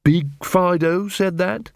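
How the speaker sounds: slow, high, rising question; tentative guess. Acted. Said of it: "Angua hazarded".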